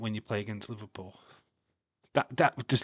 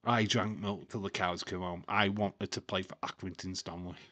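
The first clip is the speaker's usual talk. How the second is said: normal talking voice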